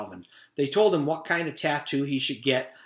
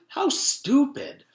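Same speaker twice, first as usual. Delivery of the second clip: scornful; 14 semitones higher, 5 dB louder, much slower than usual. Acted. Classmate mocking the tattoo.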